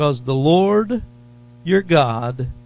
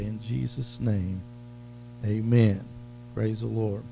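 prayer; 3 semitones lower, 4 dB quieter than usual. Acted. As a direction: pray softly to the Father. Opening prayer.

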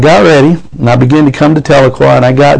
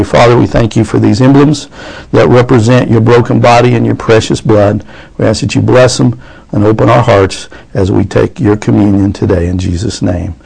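low, subdued communion prayer; spoken prayer; 4 semitones lower than usual. Real, not acted. Pastor blessing the communion emblems before serving, prayerful. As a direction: pray simply in a lowered voice, settling at the end.

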